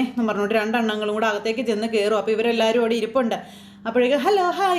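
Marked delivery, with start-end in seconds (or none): 4.2-4.8 high, bright sing-song hello; chirpy greeting. Retold or imitated. Krishna greeting the visiting uncles, high chirpy hello. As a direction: greet them like an eager young girl.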